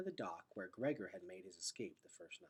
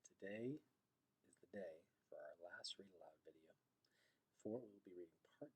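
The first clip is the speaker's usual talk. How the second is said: hushed intro; 5 semitones lower, 13 dB quieter than usual. Real, not acted. Teacher opening read aloud.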